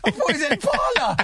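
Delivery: high, giggly retelling through laughter; can barely get the name out for laughing. Real, not acted. Laughing at his own mistake.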